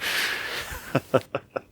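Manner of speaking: quiet breathy chuckle; soft laugh. Real, not acted. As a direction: chuckle at the joke. Joke about murdering on stage.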